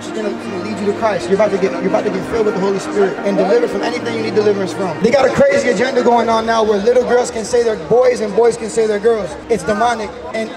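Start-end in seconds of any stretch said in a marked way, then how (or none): none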